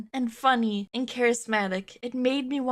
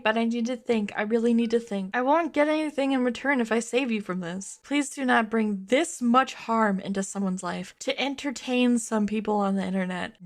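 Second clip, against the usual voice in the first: ordinary talk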